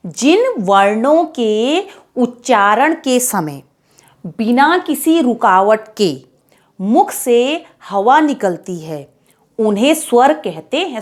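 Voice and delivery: slow, spaced-out definition; teacher dictating to children; slow dictation. Shown as a demonstration. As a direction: dictate it slowly, phrase by phrase. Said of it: "teacher dictating definition".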